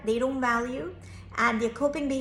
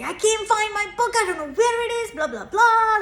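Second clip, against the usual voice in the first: imitating an angry child, much higher and louder; upset child; 11 semitones higher, 8 dB louder than usual. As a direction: play the frustrated kid near meltdown. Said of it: "I hear him start to get angry".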